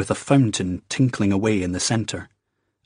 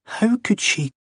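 high, helpless plea; 13 semitones higher than usual. Acted. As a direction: throw up your hands, high and lost. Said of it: "he said helplessly".

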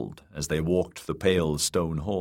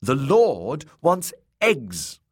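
put-on slow, high, loud childish demand; petulant high demand; imperious tantrum; 8 semitones higher, 5 dB louder, much slower than usual. A narrator's reading. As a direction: hammer out each word like a spoilt lord. Boy flings spoon and demands eggs.

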